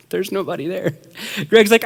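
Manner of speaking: usual speaking voice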